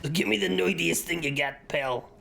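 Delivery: brash, put-on New Yorker; New Yorker accent. Gift buyer kicking in shop door, mock New Yorker accent. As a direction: barge in as a hammed-up New Yorker. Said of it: "the New Yorker accent in my head".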